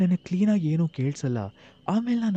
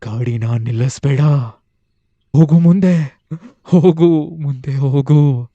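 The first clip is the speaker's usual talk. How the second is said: ಜೋರಾಗಿ ಅಳುತ್ತಾ ಆಜ್ಞೆ; loud crying command; 10 dB louder than usual. Acted. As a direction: wail the order to keep driving. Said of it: "ಅಳ್ತಾ ಅಳ್ತಾನೆ ಜೋರಾಗಿ ನನಗೆ ಈ ಥರ ಹೇಳ್ತಾಳೆ".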